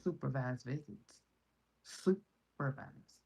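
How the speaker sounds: Supervised drawn out slow with a falling tune; slow, pointed Supervised; stress on Supervised. Real, not acted. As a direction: lean on Supervised. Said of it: "emphasis on supervised".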